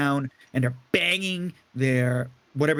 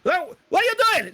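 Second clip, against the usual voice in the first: much higher, shrill pleading little owner; frantic owner. Acted. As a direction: shriek at them to stop. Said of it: "owner's like".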